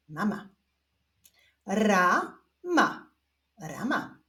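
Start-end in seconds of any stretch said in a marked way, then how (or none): 1.7-3.1 stretched first syllable, pause, then second; drawn-out, divided syllables; syllable reading. Shown as a demonstration. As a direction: read it slowly as two separate syllables. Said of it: "zapraszam Was do odczytywania sylab".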